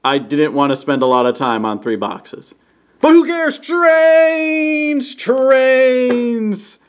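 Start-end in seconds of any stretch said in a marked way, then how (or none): 3.0-3.6 squealing high with glee; excited. Real, not acted. Toy reviewer to viewers, high excited squeal. 3.6-5.2 high gleeful shout of the word; excited shout. Real, not acted. Toy reviewer cheering his trains, high gleeful shout. 5.2-6.8 high sung-out cheer; excited cry. Real, not acted. Toy reviewer cheering his trains again, high cheer.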